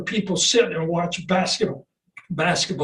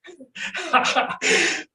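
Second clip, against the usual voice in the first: laugh; 10 semitones higher than usual. Real, not acted. Laughing at retold rejection.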